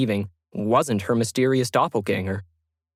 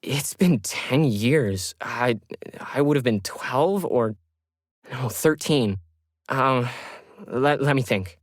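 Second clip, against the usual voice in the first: slow, halting, unsure recall; Lauren hesitates over old email to agent; hesitant fumbling; slower than usual. Acted. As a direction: stumble while searching memory.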